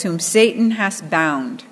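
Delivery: slow, weighty proclamation. Acted. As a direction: let each word land slowly. Jesus quoted with weight.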